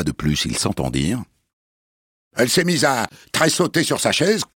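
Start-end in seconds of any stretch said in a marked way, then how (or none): 2.3-4.4 high, hurried nervous story; high-pitched excuse. Acted. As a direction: rattle off the story. Headmistress repeats story, high.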